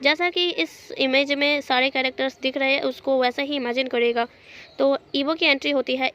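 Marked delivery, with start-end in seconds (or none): none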